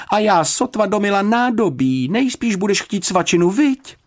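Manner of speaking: high, loud, fussing housekeeper; flustered dismay. A narrator's reading. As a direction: fret loudly in a high voice. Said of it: "vyhrkla zděšeně".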